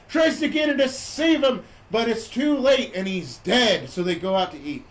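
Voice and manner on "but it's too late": ordinary delivery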